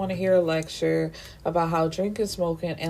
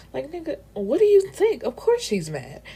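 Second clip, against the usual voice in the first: exasperated; 8 semitones higher than usual. Real, not acted. Exasperated commentary on drunk Rule.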